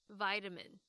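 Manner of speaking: American vitamin, VAI-ta-min; 美式发音的vitamin; American pronunciation. Shown as a demonstration. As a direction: say vitamin the American way, first syllable like "eye". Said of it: "美式英文的话呢，就通常会听到像这样子的发音".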